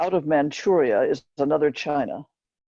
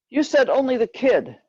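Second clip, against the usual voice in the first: higher, sharp retort; passengers arguing back; 9 semitones higher than usual. Retold or imitated. A passenger argues back at the girl at the window, higher and sharp. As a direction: throw her own words back at her.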